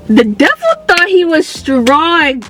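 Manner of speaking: high, loud preacher-like declaration; fired-up testifying. Real, not acted. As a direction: proclaim it like a church testimony.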